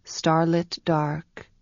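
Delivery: ordinary delivery, nothing marked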